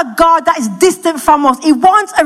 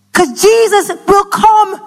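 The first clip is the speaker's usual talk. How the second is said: normal talking voice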